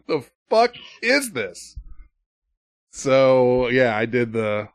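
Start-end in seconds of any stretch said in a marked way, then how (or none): 0.1-1.8 baffled disgust. Retold or imitated. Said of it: "I'm just like".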